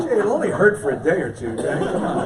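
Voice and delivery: laughing as you urge him on; laughing coaxing. Retold or imitated. Retelling coaxing the boy before a cut, laughing.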